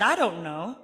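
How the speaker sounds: laughing through the words. Retold or imitated. Laughing as she answers the curious little boy. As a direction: giggle out an answer, amused. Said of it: "I was laughing".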